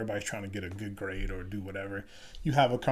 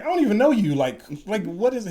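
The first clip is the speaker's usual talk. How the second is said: much higher, louder incredulous reply; new officer baffled by sergeant; mock startled; 11 semitones higher, 9 dB louder than usual. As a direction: sound caught off guard and baffled.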